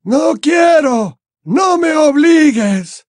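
very high, drawn-out pleading; frightened old man's anguished cry; terrified, unrecognisable voice. A narrator's reading. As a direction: beg in terror, stretching the words. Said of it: "nunca lo había visto tan asustado".

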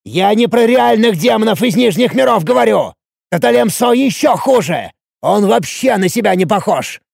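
high, heated insistence; горячий спор. A narrator's reading. Спор на крике продолжается. (горячо, на повышенных тонах)

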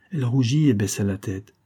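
plain reading voice

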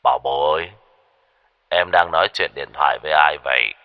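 low villain voice; menacing hotel owner. A narrator's reading. Hotel owner catching the woman on the phone, low menacing voice. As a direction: drop low and question the captive, possessively. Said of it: "tiếng của chủ khách sạn".

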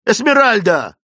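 loud, high rousing shout. A narrator's reading. Clayton roughly shaking Esmeralda by the shoulder, shouting. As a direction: yell the name sharply to wake her. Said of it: "снова закричал он".

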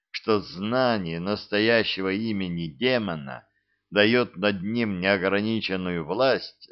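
plain reading voice

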